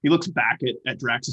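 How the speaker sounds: ordinary talk, nothing marked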